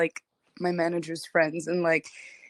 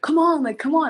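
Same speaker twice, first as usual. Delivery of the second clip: coaxing manager; 7 semitones higher, 5 dB louder than usual. Retold or imitated. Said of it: "And he's like".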